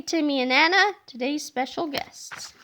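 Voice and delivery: usual speaking voice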